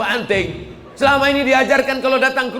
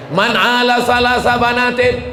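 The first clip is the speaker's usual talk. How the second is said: raised, chanted Arabic hadith line; hadith recitation; 2 semitones higher, 5 dB louder than usual. Acted. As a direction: recite it like a preacher declaiming. Preacher recites hadith again.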